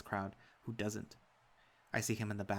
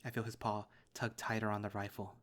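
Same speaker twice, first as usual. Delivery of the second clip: ordinary delivery, nothing marked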